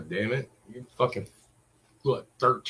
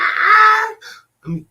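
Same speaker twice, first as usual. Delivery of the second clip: mock wail; 10 dB louder than usual. Mock crying carries over.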